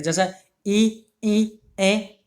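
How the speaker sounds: ई and ए sounded slowly and deliberately; teacher demonstrating front vowels; front vowel demo. Shown as a demonstration. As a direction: slowly voice ई then ए for students. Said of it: "जीभ का अग्रभाग".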